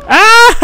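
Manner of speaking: high startled shriek; startled scream. Real, not acted. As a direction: shriek when grabbed suddenly. Caught by enemy.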